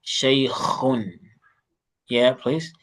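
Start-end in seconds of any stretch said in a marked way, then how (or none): 0.1-1.1 Shaykhun with a throaty kh from the throat; model pronunciation. Shown as a demonstration. Teacher models kha for a struggling student, throaty kh. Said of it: "work on the way you pronounce kha".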